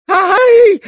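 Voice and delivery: very high, loud yelp of fright; old woman's shriek. A narrator's reading. Old woman screamed at by Mitya, frightened shriek. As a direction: shriek out in alarm. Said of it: "закричала старушонка".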